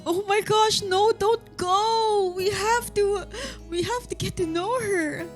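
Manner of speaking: high, drawn-out begging; pleading. Real, not acted. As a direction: beg her not to leave. Pleading to character.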